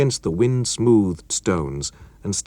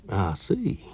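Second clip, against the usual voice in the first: ordinary delivery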